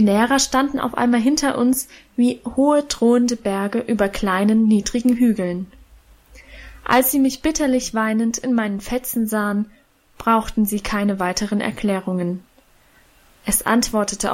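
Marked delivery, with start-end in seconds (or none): none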